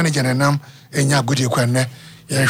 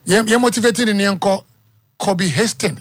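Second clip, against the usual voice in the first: normal talking voice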